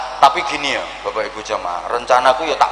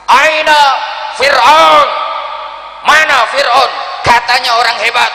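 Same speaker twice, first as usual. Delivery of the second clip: high, loud, ringing shout; loud divine call; 11 semitones higher, 5 dB louder than usual. Acted. Preacher voicing God calling out Pharaoh loudly. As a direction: thunder the name as God calling. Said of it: "dengan suara keras itu".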